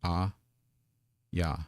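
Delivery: ordinary talk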